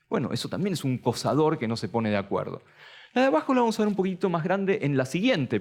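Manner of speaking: normal talking voice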